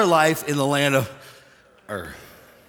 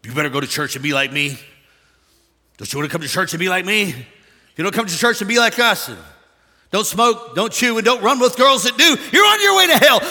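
mock hellfire preacher, climbing higher and louder to a shout; judgmental preacher; 6 semitones higher, 8 dB louder than usual. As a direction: scold them toward hell, building to a yell. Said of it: "I don't sell the".

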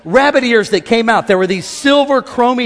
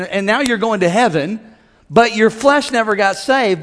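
normal talking voice

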